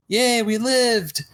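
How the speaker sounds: normal talking voice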